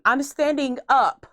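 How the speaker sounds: normal talking voice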